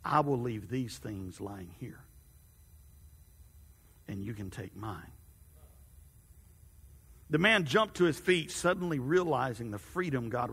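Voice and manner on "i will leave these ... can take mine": a quiet, tender God offering rest; God's voice. A narrator's reading. Narrator voices God gently.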